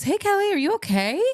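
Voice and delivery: high, gentle caring mom voice; lightly voiced concerned mom; sweet motherly voice. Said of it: "she goes".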